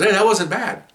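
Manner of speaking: high, impressed retold quip; impressed reaction. Fighter reacting to a pretty good one, high impressed call. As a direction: toss it out high, as if pleasantly impressed.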